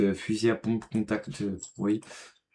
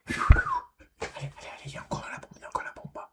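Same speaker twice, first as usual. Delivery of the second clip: hushed urgent whisper; 5 dB quieter, much faster than usual. Real, not acted. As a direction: whisper it fast to the team. Whispered bomb callout in game. (chuchoté)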